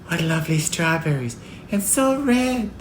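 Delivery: put-on high child voice, lingering admiration; delighted little girl. A narrator's reading. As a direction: marvel like a small child.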